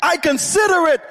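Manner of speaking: high, sustained preacher's cry; impassioned pulpit fervour, pitched far up; full-force preaching. Real, not acted. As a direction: build to a soaring, shouted declaration.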